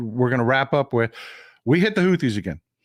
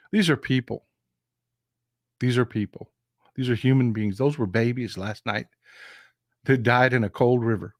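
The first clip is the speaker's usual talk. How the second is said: low, subdued sorrow with long pauses; sombre grief; 3 semitones lower than usual. Real, not acted. Grieving babies drowned last night.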